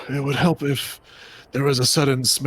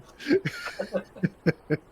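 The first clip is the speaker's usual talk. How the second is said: soft, short chuckle; soft laugh; 9 semitones higher, 4 dB quieter than usual. Real, not acted. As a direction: chuckle quietly. Laughs at trust joke.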